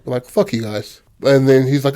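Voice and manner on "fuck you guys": usual speaking voice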